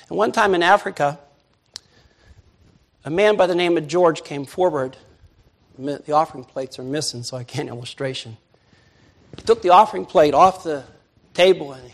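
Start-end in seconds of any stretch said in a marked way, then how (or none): none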